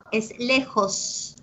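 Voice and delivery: "lejos" slow with the final s sounded; slow correct s. Shown as a demonstration. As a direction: model "lejos" with the s. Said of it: "se come la S". ("lejos" lento, con la s final)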